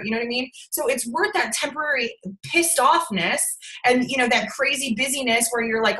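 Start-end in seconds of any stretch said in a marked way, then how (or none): none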